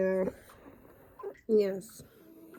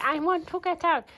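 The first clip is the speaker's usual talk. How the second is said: higher, mock whiny dog voice; voicing the dog; 6 semitones higher, 3 dB louder than usual. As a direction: speak for the dog in a higher pitch. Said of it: "She's like".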